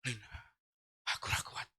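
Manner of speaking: weak, soft, almost voiceless gasp; faint and breathless. Retold or imitated. Husband tells wife he is failing, breathless whisper. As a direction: plead with no air left. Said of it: "Saya ngomong sama istrinya".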